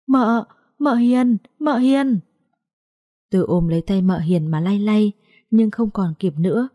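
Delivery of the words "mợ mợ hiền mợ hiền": high, slow, drawn-out cry of her name. Acted. The niece cries out as her aunt passes away, slow and high. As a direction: call her name as she slips away.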